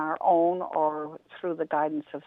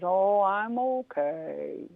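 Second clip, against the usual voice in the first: very slow, unbothered turtle; drawn-out and content, in no hurry; slow calm turtle; much slower than usual. Acted. Turtle turning down Rabbit, very slow and calm.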